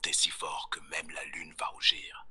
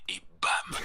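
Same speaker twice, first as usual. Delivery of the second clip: ordinary delivery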